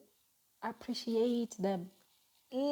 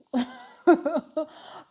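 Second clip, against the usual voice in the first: high, loud laugh; real amused laughter; 7 semitones higher, 6 dB louder than usual. Real, not acted. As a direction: burst out laughing at your own remark. Laughs at her own remark.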